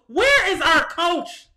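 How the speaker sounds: slow, loud and high, demanding an answer; angry demand. Real, not acted. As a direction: call the coach out, drawn out. Fan ranting about the coach.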